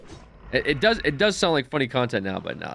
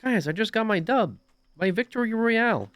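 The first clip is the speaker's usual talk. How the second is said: put-on high-pitched seven-year-old bragging about a win; mock excited kid; 10 semitones higher than usual. Streamer mocking a player announcing a win, high childish voice. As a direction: mimic an excited little nephew, pitched way up. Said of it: "seven years old, running into the living room".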